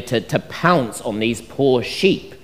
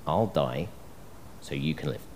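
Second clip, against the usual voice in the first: low, soft promise; quiet self-sacrifice; 7 semitones lower, 10 dB quieter than usual. Acted. Shepherd giving his life for his sheep, low and quiet. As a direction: lower and soften, letting it settle.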